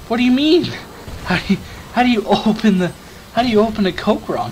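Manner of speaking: can't believe what he's hearing; incredulous. Retold or imitated. Told he opens Coke wrong, answering in high disbelief. Baffled by Coke-opening claim.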